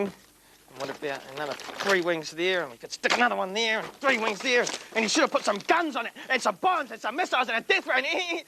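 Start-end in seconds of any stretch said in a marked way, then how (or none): 4.9-8.4 rising excitement, fast; excited. Acted. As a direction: rattle it off, getting carried away. Getting carried away.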